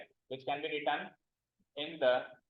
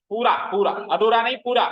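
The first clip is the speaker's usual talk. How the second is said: louder insistence on पूरा; emphatic; 8 dB louder than usual. Real, not acted. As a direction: drive the word पूरा home to the class. Teacher insists on meaning complete. (पूरा पर ज़ोर देते हुए, ऊँची आवाज़ में)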